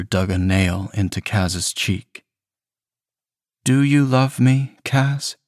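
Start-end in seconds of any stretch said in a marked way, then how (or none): 3.6-5.4 lightly voiced boyfriend, pitched higher, coaxing; sugary guilt-trip, smooth and needling; manipulative boyfriend. A narrator's reading. Boyfriend coaxing his guilty partner, in a higher, sweetly needling voice. Andrew demands love.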